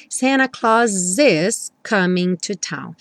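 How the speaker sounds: slow, "Claus" S joined to "is"; linked Claus is. Shown as a demonstration. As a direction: glide "Claus is" together. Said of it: "o S do Santa Claus já juntou com is". ("Claus is" ligado, devagar)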